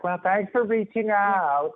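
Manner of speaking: high, slow, sugary dismissal; brush-off. Retold or imitated. LA receptionist brushing him off, high slow sugary politeness. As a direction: sweetly show them the door. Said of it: "they're like".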